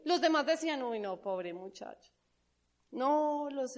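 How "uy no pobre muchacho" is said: mock pity. People pitying José, acted quote.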